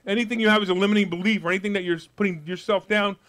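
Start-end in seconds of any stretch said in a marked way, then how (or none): none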